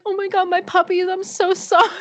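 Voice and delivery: high-pitched cooing apology, breaking into laughter; mock distraught apology. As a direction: apologise to neglected puppies in exaggerated distress. Retold apology to neglected virtual puppies.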